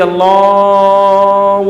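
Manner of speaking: the word Allah dragged out very slowly, far too long; overlong stretched call. Shown as a demonstration. Preacher mocking muezzins who drag out the azan, slow stretched call. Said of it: "وہ اتنا کھینچتا ہے اذان کو".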